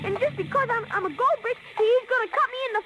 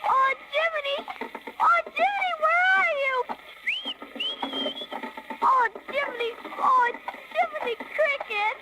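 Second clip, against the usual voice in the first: higher, calling into the distance; 7 semitones higher than usual. Acted. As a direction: call desperately for his friend. Pinocchio calls for his friend.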